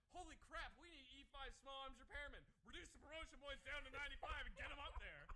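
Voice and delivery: high, urgent mock Army order; mock alarm. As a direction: imitate a panicked institution giving orders. Acted Army panicking over shortage.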